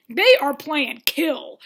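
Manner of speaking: deadpan slow emphasis; emphatic. Real, not acted. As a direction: spell it out slowly for effect. Emphatic punchline.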